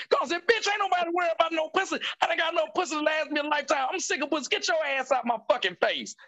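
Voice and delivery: high-pitched, fed-up mock tirade; retold angry outburst. Friend snapping back at a woman's accusation, mock outrage. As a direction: act out someone blowing up, pitch way up.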